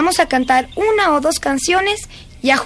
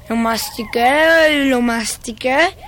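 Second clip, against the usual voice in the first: slow savouring; 3 semitones lower, slower than usual. Acted. Child relishing retold story.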